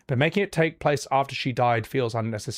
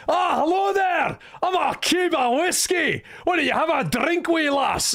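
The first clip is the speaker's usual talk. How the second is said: put-on Scottish accent; 13 semitones higher than usual. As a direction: hail them like a jolly Scottish barman. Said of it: "give it a Scottish accent or something".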